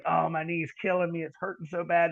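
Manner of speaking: ordinary delivery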